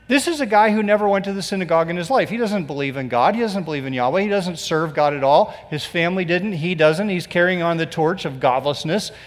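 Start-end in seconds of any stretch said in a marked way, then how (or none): none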